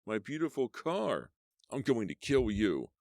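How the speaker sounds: from moaning loss to rage; groaning man shouting a threat; pained threat. Acted. Injured killer threatening.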